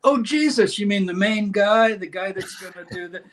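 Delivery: high, mock-amazed question, fading away; mock surprised reader. Modern reader reacting to Jesus' name: mock astonishment. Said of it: "today people read that".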